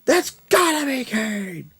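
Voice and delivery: high, slow, drawn-out hype call; shrill-pitched wrestling commentator chant; wrestling announcer call. Retold or imitated. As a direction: call his name like a ringside commentator. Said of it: "I always go".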